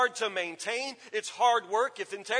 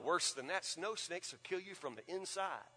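hushed spooky dad; 2 semitones lower, 10 dB quieter than usual. Retold or imitated.

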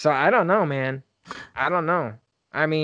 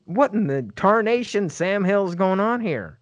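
high, hammed-up Texan accent; 6 semitones higher than usual. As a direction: play an old Texan prospector with a Texan accent. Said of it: "That, that was the most Texan thing".

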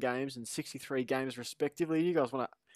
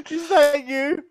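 much higher and louder, still laughing; delighted surprise; 13 semitones higher, 10 dB louder than usual. Real, not acted. Host asking a co-host about his message in laughing surprise. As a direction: squeal the question in delight.

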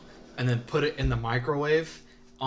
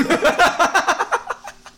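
very high, loud cackle; shrieking laughter; laughing; 9 dB louder than usual. Real, not acted. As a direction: crack up loudly and high. Cracking up at a word.

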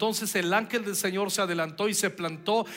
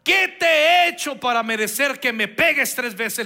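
louder, higher indignant donkey; 5 semitones higher, 8 dB louder than usual. A narrator's reading. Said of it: "le preguntó a Balaam".

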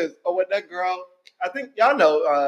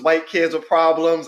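ordinary delivery

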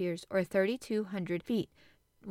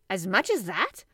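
surprised; 7 dB louder than usual. A narrator's reading. Ned astonished.

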